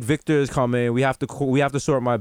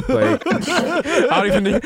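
high laugh through words; 10 semitones higher, slower than usual. Real, not acted. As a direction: laugh while you talk. Laughing while speaking.

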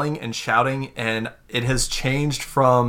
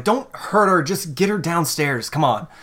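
a pleading voice, higher, easing toward her own; Zophia urging soldiers; 6 semitones higher than usual. Acted. Zophia to soldiers dragging the player, urgent higher plea. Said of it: "She's like".

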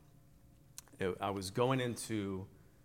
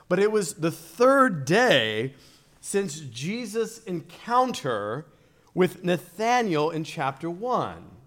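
high, loud sermon exposition with weighty pauses; emphatic preaching; 7 semitones higher, 11 dB louder than usual. Real, not acted. Preacher expounding the third day at Cana, emphatic preaching. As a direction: drive each key phrase home to the congregation.

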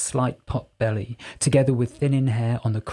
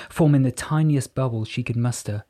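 usual speaking voice

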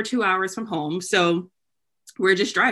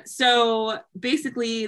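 normal talking voice

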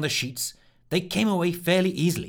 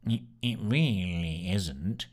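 lower, slow, dull little rat voice; 5 semitones lower, 3 dB quieter, much slower than usual. Acted. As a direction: say it flat like a sulky rat. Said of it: "came a rat-like voice".

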